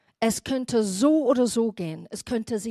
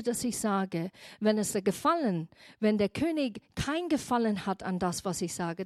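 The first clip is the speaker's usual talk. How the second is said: ordinary delivery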